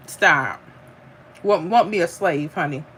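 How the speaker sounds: ordinary talk, nothing marked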